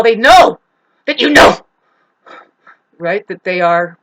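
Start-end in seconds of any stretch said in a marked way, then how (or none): 0.0-1.6 loud stress on each "know"; punched KNOW. Real, not acted. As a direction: hit "know" hard and loud both times. Emphatic point about narcissists' vendetta.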